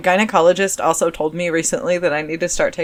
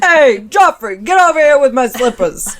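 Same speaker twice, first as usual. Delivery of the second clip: mob boss voice; 6 semitones higher, 7 dB louder than usual. Retold or imitated. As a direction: lean into a tough boss calling. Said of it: "she probably sounds more like this".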